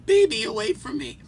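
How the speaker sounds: high wailing woman's voice; distraught woman. Retold or imitated. Hotel story, crazy woman wailing for her baby. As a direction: wail like a frantic mother.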